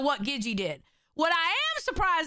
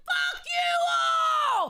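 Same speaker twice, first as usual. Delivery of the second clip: drawn-out high shout at everyone; yelling defiantly; 12 semitones higher, much slower than usual. Pretend. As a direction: yell it slowly from the deathbed, all defiance. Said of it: "and yelling".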